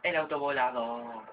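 ordinary talk, nothing marked

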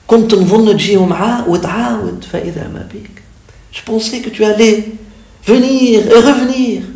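very high, impassioned devotional plea; pleading recitation. Acted. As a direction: cry out to the absent beloved, voice lifted. Recites the poem's reproach to the beloved. (supplication ardente, voix haut perchée)

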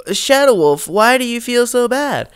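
very high mock voice of a questioning fan; imitating viewers. Viewers asking why he feels bad, high mock voice. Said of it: "you're probably asking yourself now".

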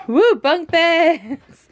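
high, slow, drawn-out whoop of delight; excited cheer. Real, not acted. Kids' bunk beds, gleeful cheer.

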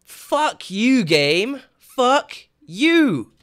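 loud, drawn-out curse with swooping pitch; exasperated cursing. Real, not acted. Player cursing the game after a failure, exasperated. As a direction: curse the game, leaning on each word.

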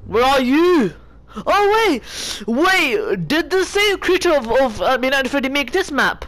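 startled and urgent; surprised. Real, not acted. Sudden alarm in game.

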